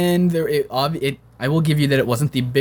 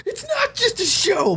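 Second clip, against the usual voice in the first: high, slow, half-laughing wail; mock anguish; slower than usual. Inner reply to yelling fans.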